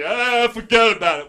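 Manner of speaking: a loud, hammed-up Jersey "fuhgeddaboudit"; mock New Jersey accent. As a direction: play the everybody-else's-Jersey cliché. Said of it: "that's not really my impression of New Jersey".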